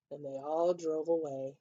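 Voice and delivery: ordinary delivery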